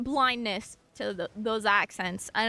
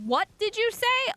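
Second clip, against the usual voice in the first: baffled question; 10 semitones higher than usual. Retold or imitated. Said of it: "You're just like".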